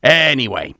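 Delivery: ordinary talk, nothing marked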